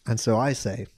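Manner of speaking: ordinary talk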